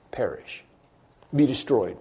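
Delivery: usual speaking voice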